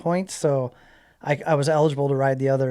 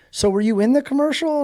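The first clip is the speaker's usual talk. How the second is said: higher-pitched retold woman's voice; imitated phone lady; 10 semitones higher than usual. Clerk checking a caller's records, imitated in a raised pitch. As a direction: lightly play the lady on the line, pitched well up.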